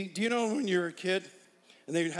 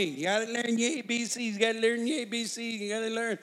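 higher, insistent teacher voice; strict teacher; 5 semitones higher than usual. Retold or imitated. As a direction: nag like a schoolteacher. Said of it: "He's gonna be that teacher".

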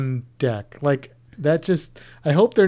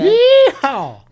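yeehaw; 13 semitones higher, 8 dB louder than usual. Pretend. Cheering during hillbilly reading.

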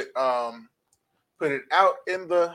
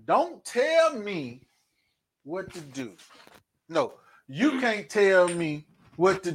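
slow, emphatic title read; slow emphatic announcement; announcing the topic; 3 semitones higher, slower than usual. Shown as a demonstration. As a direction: spell out the show's topic slowly. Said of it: "Tonight's topic is".

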